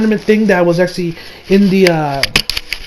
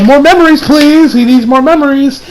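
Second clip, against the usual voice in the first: put-on pleading kid; 6 semitones higher, 4 dB louder than usual. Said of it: "the kid's like".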